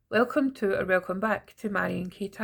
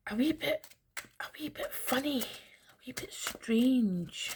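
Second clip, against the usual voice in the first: whisper; 5 semitones higher, 5 dB quieter than usual. Real, not acted. Confiding odd energy to viewers.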